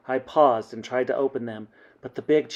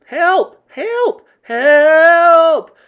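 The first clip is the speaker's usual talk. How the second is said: high, loud yells, last one drawn out; screamed cries for help; 13 dB louder, much slower than usual. A narrator's reading. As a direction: yell for someone outside to hear. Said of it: "I screamed".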